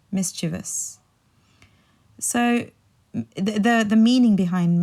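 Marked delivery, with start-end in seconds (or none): 0.1-1.0 mischievous softly as MIS-chuh-vus; softer repeat of mischievous, stressed on MIS; correct mischievous. Shown as a demonstration. Teacher repeats the correction, mischievous softly in three syllables. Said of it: "but it's actually mischievous".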